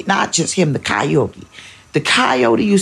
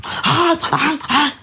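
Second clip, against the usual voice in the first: put-on kids' whining protest; 12 semitones higher, slower than usual.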